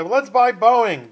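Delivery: loud, high gung-ho quote; playfully overexcited pitch; mock eager investors. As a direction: imitate club members keen to buy. Imitates eager investment club members.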